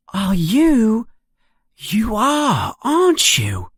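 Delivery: put-on high, excited boy asking in disbelief; boy stunned to meet a famous classmate; amazed recognition. A narrator's reading. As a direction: gasp as if meeting a celebrity. Ron recognizes Harry, amazed.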